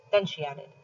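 usual speaking voice

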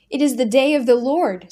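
high, loud ecstatic cry above a crowd; screamed. A narrator's reading. A voice in the crowd hails the Lord, screaming. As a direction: cry it out over the roar. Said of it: "screamed a voice above all the rest".

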